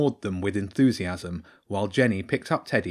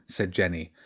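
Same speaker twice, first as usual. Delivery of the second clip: plain reading voice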